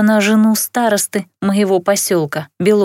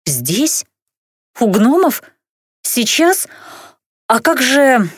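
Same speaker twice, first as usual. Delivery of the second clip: alarmed surprise; 5 semitones higher, slower than usual. Acted. Startled bride's rapid questions.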